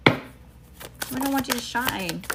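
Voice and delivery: slowed, drawn-out sassy repeat; slow, knowing emphasis. Real, not acted. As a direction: drag it out like a pointed warning. Repeats line, second more drawn out.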